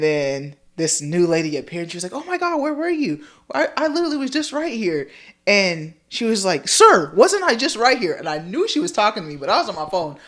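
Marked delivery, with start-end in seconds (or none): 2.1-5.1 much higher, hurried, put-on lady voice; indignant lady. Lady at Kohl's wonders where someone went, indignant. 6.7-8.1 high, loud mock demand; indignant lady. Lady at Kohl's addresses a supposed sir, indignant.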